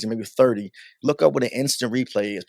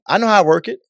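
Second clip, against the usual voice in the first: imitating his overeager bluff, higher and louder; retold false confidence, pitched up; 7 semitones higher, 6 dB louder, faster than usual. Retells bluffing to his boss.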